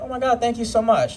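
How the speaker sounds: a hint of a delighted young woman; flattered girl. Girl's reply voiced higher.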